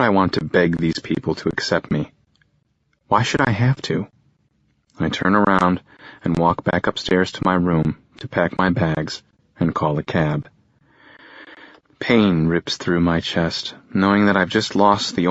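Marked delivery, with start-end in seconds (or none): none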